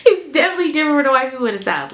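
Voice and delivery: usual speaking voice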